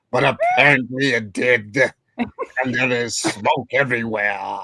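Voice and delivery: slow, pompous aristocrat voice; hammed-up noble scolding the peasants, words drawn out; aristocratic. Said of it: "I'll do it like that".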